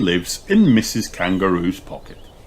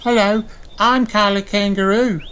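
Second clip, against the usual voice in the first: put-on very high child kangaroo voice; chirpy high little girl introducing herself; young kangaroo greeting new friends; little girl Kylie; 13 semitones higher than usual. A narrator's reading. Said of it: "says Kylie".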